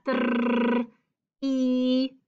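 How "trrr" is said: long rolled "Trrr"; rolled trr sound. Shown as a demonstration. Teacher sounding out TR for children, rolled. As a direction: buzz out "Trrr" with a trilled r. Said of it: "¿Cómo suena?".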